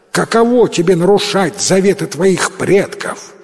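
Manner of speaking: loud, high accusing prince; stern accusation. Acted. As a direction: rebuke him with righteous anger.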